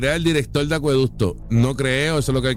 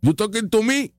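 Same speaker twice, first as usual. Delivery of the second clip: much higher, incredulous You talking to me, repeated; mock indignant; 11 semitones higher than usual. Host mocking the politician's offended reaction, high and incredulous. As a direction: play an offended big shot demanding respect. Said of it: "y ahí se agitó Juan".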